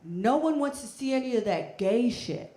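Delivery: loud, angry bigot's yell; mock yell. Stranger on the train yelling at the couple, loud and angry. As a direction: bark it like an outraged stranger. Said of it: "this man then immediately yelled".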